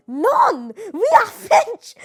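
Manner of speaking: loud, drawn-out French-Canadian protest; mock Quebec French speaker. Mimicking Quebec refusing English, as a loud mock French speaker. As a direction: proclaim it like an indignant Quebecois, high and slow. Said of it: "In Quebec, they're like".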